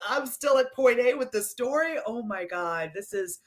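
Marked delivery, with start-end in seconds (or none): 2.1-3.4 hushed, breathy Oh my God; stunned, half to herself; overwhelmed whisper. Real, not acted. Host overwhelmed by the story.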